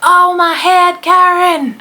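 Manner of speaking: loud, high, drawn-out mock Southern wail; Southern accent. Man bangs his head in the tunnel and wails to his wife, mock Southern accent. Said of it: "I don't know why I turned southern".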